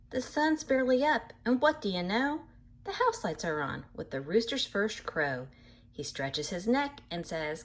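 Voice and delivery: lively storybook reading voice; animated picture-book narration; reading to children. A narrator's reading. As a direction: read it bright and sing-song for little listeners. Reads book aloud to children.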